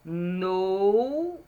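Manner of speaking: "Noura" with a long gliding "nou", then "ra"; drawn-out syllables. Shown as a demonstration. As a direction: stretch every sound in "Noura". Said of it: "tem três sons no no".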